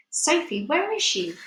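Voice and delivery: high, anxious plea that rises on the question; a worried giant searching in panic; desperate. Acted. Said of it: "he thought desperately".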